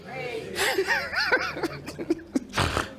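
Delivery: high, manic burst of laughter; crazed cackle. Acted. Teacher showing students how crazy the king was, crazed cackle. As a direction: cackle like a madman, high and drawn out. Acting out the king's madness.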